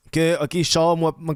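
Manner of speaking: normal talking voice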